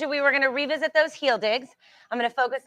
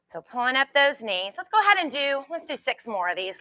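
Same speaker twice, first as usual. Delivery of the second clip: normal talking voice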